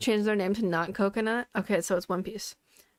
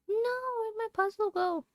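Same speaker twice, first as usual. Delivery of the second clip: silly high voice; 12 semitones higher than usual. Acted.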